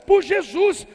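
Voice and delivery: loud, high fiery sermon; full-force preaching. Real, not acted. Pastor urging believers to fight, preaching loud. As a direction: drive it home to the congregation.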